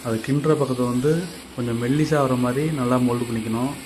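normal talking voice

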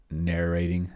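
ordinary delivery

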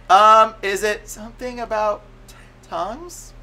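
high, halting question that shrinks away; hesitant, imitated. Man put on the spot before the group, high and hesitant. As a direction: stammer upward into a tiny last word. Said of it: "tears are starting to leak down".

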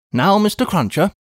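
a brisk English housekeeper calling out; a hint of a bustling maid; Miss Pross. Miss Pross voiced by narrator.

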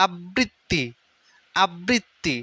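আবৃত্তি split as "ab-brit-ti"; repeated syllable drill. Shown as a demonstration. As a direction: pause on the doubled b and t of আবৃত্তি. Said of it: "এটা আমি কেন বারবার পড়তেছি". (আবৃত্তি ভেঙে "আব্-ব্রিৎ-তি")

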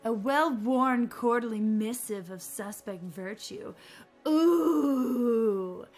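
hammed-up recital of a naughty codex; playfully grand reading voice; dramatic reading. Reading game book aloud theatrically.

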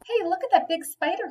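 high, eager exclamation; excited. A narrator's reading. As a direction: point it out thrilled. Boy excited by spider.

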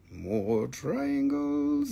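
quiet, very slow, rising at the end; soft drawn-out echo. Real, not acted. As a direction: savor it under your breath. Repeats reveal softly.

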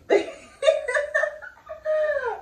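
high, loud burst of laughter; long high-pitched laugh. Real, not acted. Vlogger cracking up at the start, laughing. Laughs during her intro.

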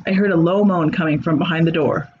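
usual speaking voice

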